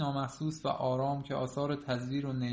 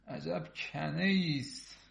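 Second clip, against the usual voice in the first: ordinary delivery, nothing marked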